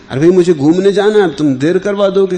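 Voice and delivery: retold impatient protest. Sage to devotee blocking his walk, impatient protest. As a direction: protest at being held up, quickly.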